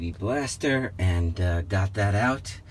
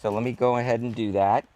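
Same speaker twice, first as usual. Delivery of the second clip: ordinary talk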